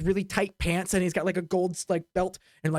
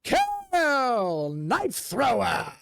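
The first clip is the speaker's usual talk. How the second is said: slow, showy big-top announcer; slow, grand circus introduction; ringleader announcer; much slower than usual. Acted. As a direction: draw it out like a ringmaster. Ringleader introduces the knife act.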